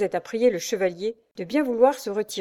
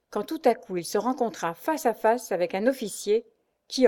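ordinary delivery

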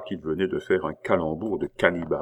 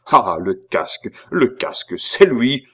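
exclamation climbing higher and louder; sudden indignant illumination; 4 semitones higher, 7 dB louder than usual. A narrator's reading. Abbé spotting the helmet, suddenly indignant. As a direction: cry it out as revelation strikes. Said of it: "illumination subite et de généreuse indignation".

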